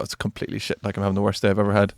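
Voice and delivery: gloomy oversharing. Retold or imitated. Answering small talk far too honestly, mock gloomy and low. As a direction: unload a bad day under the breath.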